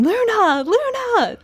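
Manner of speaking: high-pitched mock yell, drawn out; excited little kid's squeal, imitated; imitated toddler yelling. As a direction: call out like an excited toddler, without full volume. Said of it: "toddler was literally yelling".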